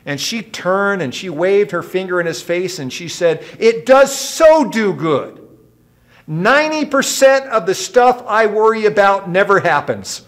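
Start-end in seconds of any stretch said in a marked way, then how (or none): 3.6-10.2 a hint of a wife snapping back; indignant wife. Wife wagging her finger at her husband, mildly indignant.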